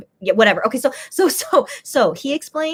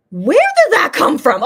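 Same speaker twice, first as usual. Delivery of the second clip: louder, puzzled exclamation; baffled; 4 semitones higher, 8 dB louder than usual. Retold or imitated. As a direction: exclaim it, caught off guard. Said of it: "I'm like".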